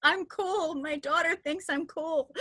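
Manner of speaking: high squeal breaking into laughter; re-enacted tickled mom; giddy pride. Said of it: "I was just like".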